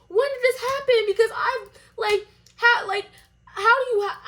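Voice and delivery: high, loud, words breaking off; so worked up the question won't finish; sputtering outrage. Retold or imitated.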